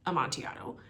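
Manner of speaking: hushed, conspiratorial aside; whispered aside. Retold or imitated. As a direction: whisper the name as a secret. Whispered wine name in paraphrase.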